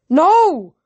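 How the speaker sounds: high, loud cry of anger and frustration; anguished yell. A narrator's reading. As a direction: cry out as the friend is lost. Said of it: "I yelled in anger and frustration".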